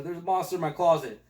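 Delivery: a hint of a child's voice; kid in the film. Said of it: "whenever the kid goes".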